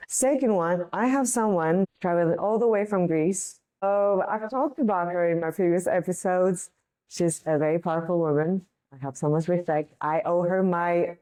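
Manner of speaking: ordinary talk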